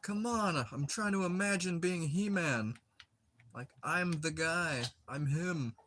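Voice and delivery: a hint of high-voiced kid protest; imitated pleading kid. Replays child's superhero protest.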